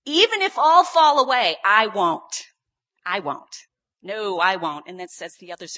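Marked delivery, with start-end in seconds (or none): 0.0-2.5 loud, high-pitched vow; emphatic insistence. Retold or imitated. Peter swearing loyalty to Jesus, emphatic shout. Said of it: "insisted emphatically". 3.0-4.9 loud repeated refusal; emphatic insistence. Retold or imitated. Peter insisting he will stay loyal, emphatic. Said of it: "insisted emphatically".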